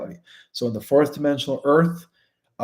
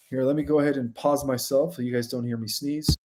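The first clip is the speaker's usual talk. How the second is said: normal talking voice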